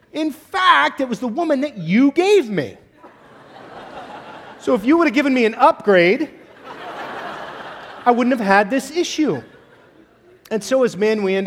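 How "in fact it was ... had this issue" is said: high, louder, laughing whiny excuse; Adam blaming Eve. Retold or imitated. Adam to God, asking for an upgrade in a high laughing whine. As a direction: plead higher, half laughing. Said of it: "He goes".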